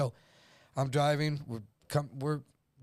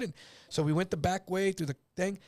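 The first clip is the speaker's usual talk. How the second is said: normal talking voice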